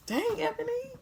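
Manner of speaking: high, startled squeal; surprised squeal. Real, not acted. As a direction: yelp it out in surprise. Startled by a slip.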